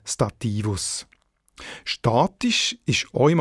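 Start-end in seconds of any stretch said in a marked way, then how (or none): 0.0-1.0 Stativus stressed on -TI-; accent the second syllable of Stativus; stress on middle syllable. Shown as a demonstration. Editor citing the Latin original, Stativus stressed on -ti-. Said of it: "die gleiche Betonung wie das latinische".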